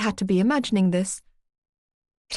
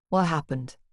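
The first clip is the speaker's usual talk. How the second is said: gentle, lower, quieter question; soft tone; 4 semitones lower, 3 dB quieter than usual. Acted. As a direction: ask softly to calm her. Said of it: "asked in a much softer tone".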